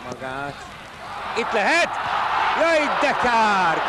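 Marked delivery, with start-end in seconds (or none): 1.4-3.9 high, loud sudden cry of dismay; excited shout. Real, not acted. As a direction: yell at the missed chance. Near miss by home side.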